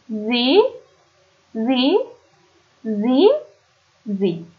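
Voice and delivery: each pronoun spoken twice, slowly and carefully; reading German pronouns aloud. Shown as a demonstration. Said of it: "از روش میخونم".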